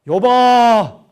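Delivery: booming divine call; God's thunderous summons. Acted. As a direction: call his name out with full force. God calling Job in sermon.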